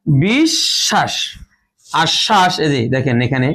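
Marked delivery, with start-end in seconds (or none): 0.1-1.1 বিশ্বাস drawn out very slowly, bish-shash; slow demonstration. Shown as a demonstration. Teacher slowly modelling বিশ্বাস for students. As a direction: stretch বিশ্বাস out syllable by syllable. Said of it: "যেমনটি দেখতে পাচ্ছেন".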